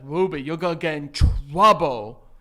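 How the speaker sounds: put-on silly baby-talk reprimand; cute dad voice. Dad reprimanding daughter in his playful voice, cutesy scolding. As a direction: scold a child without dropping the goofy voice. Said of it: "reprimand her and I keep that voice".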